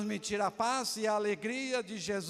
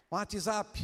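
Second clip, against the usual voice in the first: ordinary talk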